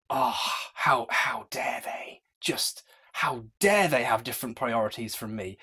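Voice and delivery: mock whiny whisper. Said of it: "you've got you going".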